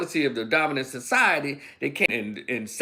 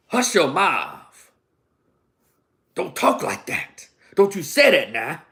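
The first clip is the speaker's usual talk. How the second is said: slow, scolding hush to silence a rebel; lightly voiced old slave, slow scolding caution; old slave's warning; 4 dB louder, slower than usual. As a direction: slowly hush someone, then a firmer don't. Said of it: "They used to say".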